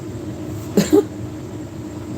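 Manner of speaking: soft, breath-only laugh; soft chuckle. Real, not acted. Woman amused by her husband's old coworkers, soft chuckle. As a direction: let out a quiet, private chuckle.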